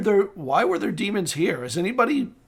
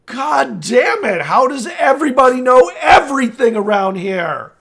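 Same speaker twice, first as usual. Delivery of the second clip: exasperated outburst; 5 semitones higher, 5 dB louder than usual. Acted. Lord fuming that everybody knows everything, exasperated.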